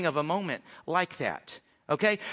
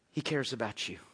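much softer and lower, tender; tender hush; 9 semitones lower, 8 dB quieter than usual. Acted. Preacher telling the congregation gently that God cares. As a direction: drop it gently to reassure. Sermon climax drops to tenderness.

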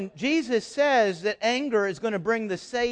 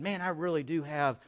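quiet inner confession; 6 semitones lower, 10 dB quieter than usual. Retold or imitated. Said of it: "you're convicted".

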